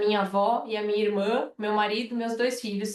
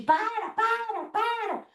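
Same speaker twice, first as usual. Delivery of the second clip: imitated child's protest; 11 semitones higher, slower than usual. Mother imitating her daughter protesting to grandpa, high mock cry. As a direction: mimic a kid calling out, high and stretched. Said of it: "ela ficou nesse negócio de".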